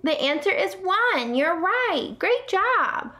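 bright high-pitched praise; high excited praise. Acted. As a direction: cheer the kids on, way up high. Praising pretend student answers.

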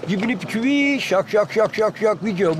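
whistly lift, struck notes, drawn ending; bird song demo. Shown as a demonstration. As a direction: imitate a finch's phrase with a held piçüvi. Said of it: "Ondan sonra gene geldi". (saka ötüşü taklidi)

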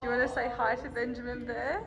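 usual speaking voice

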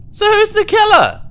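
high frantic shout; frantic yell. Real, not acted. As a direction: demand an answer. Frantic after ejection.

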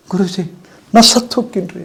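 high, imitated woman's wail; wailing disciple. Guru imitating the crying woman disciple: high mock wail. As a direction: mimic a weeping woman crying out to her guru. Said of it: "ಗೊಳೋ ಅಂತ ಒಳಗೆ ಹತ್ತಿಲ್ದು".

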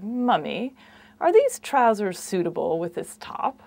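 proper English child's accent, imitated; mock-English small child; little Lord Fauntleroy. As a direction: ask it like a very formal little English kid. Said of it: "sounding like little Lord Fauntleroy".

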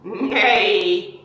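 loud playful neigh; whinny like a donkey for the kids; donkey neigh imitation. Shown as a demonstration. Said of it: "Can you do a donkey noise?".

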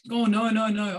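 emphatic loud refusal. Retold or imitated. Friend insisting she wants to pay for the cheese, loud and firm. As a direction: wave off the offer firmly.

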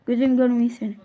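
usual speaking voice

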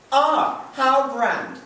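high, loud mock admiration; mock grandeur. Hansel crowning his sister queen, mock grand. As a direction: flatter her with a grin. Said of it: "he said".

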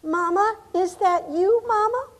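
faint, high, rising plea; soft, thin voice lifting into a question; thread-thin voice. Acted. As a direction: call out weakly, like a dying boy. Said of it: "In a thread-thin voice".

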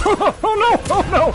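high, loud panicked wail; panicked cry. Real, not acted. Gamer reacting to sudden danger in the game, panicked cry. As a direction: cry out in alarm, high-pitched.

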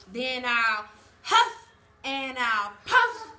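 slow, loud wolf threat. Acted. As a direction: growl out each word slowly.

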